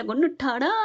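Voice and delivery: plain reading voice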